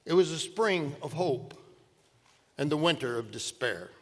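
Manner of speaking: measured literary recitation; poem recitation. Acted. Reciting Dickens opening lines.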